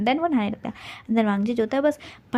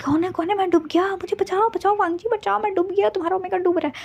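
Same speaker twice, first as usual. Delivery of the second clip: high, frantic mock drowning yelps; hammed-up panic from the bathtub; panicked cry for help; 9 semitones higher than usual. Thrown in the bathtub, calling help.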